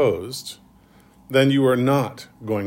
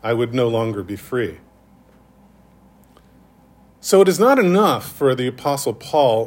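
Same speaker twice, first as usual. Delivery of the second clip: ordinary talk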